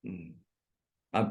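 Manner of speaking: ordinary talk, nothing marked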